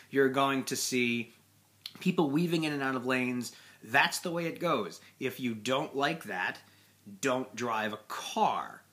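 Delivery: usual speaking voice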